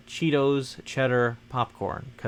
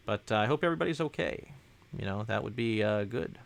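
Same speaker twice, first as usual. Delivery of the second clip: normal talking voice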